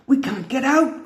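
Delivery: high alarmed cry. A narrator's reading. Shellington trapped in the tangled sub, high alarmed cry. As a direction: cry out, pitched high and worried. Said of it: "cried Shellington".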